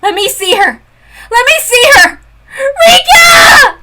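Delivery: high loud crying demand; crying plea. Acted. Girl begging the villain to see her friend, crying plea. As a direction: plead through tears.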